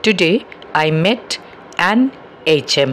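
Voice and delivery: slow an এইচ এম; slow model sentence. Shown as a demonstration. Teacher modelling the short form an HM. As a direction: say it slowly word by word. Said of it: "তাহলে বলতে হবে".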